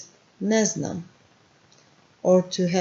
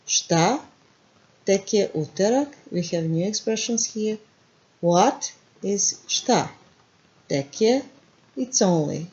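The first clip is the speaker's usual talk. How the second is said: ordinary talk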